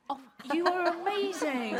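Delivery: high, loud burst of thanks; delighted. Real, not acted. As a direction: gush with delight at the rescue. Thanks someone for warming help.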